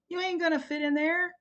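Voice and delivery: put-on high, loud child's voice; a child tells sibling while hiding; kid's voice. A narrator's reading. A loud high child speaks.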